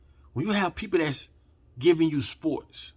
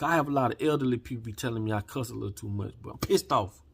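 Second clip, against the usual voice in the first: usual speaking voice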